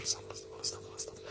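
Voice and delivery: fast whisper of repeated prayer; a quiet unvoiced repeated prayer. Shown as a demonstration. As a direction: whisper it as if counting beads. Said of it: "تمسك المسبحة وتستغفر".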